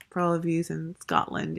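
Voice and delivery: ordinary delivery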